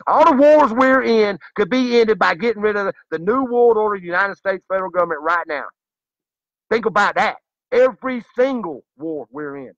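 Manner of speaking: high, loud ranting cry; fiery street-preacher outburst; passionate shout. Real, not acted. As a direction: rail against the government. Rant against the government.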